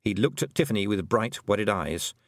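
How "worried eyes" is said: usual speaking voice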